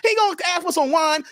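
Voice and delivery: ordinary talk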